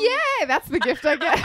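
high, loud laughing "yay"; laughing cheer. Real, not acted. Reacting to the joke gift from her co-host, laughing.

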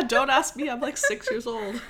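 high, laughing mock kid retort; joking imitation of a six-year-old; mock child's protest. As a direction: play along as a defensive little kid. Plays along as the child.

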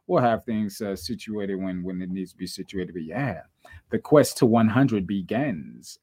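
usual speaking voice

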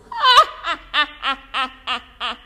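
loud evil witch cackle. Acted. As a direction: cackle wickedly. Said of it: "que deu uma risada".

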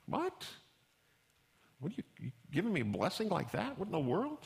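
mock shock at a strange blessing; incredulous. Preacher reacts to the elders' blessing with hammed-up disbelief.

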